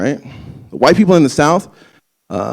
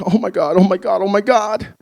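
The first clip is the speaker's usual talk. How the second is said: flustered mom; 6 semitones higher than usual. Retold or imitated. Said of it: "She's just like".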